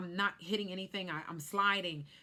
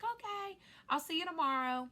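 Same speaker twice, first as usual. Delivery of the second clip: gentle sister; 10 semitones higher than usual. Retold or imitated. Sister signs off.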